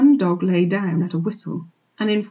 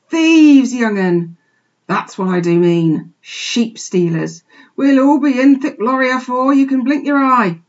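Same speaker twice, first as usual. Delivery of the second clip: loud, emphatic country sheep; exasperated old ewe; 5 semitones higher, 8 dB louder than usual. A narrator's reading. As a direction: spell out the danger forcefully to the youngster. Old ewe explains danger to piglet.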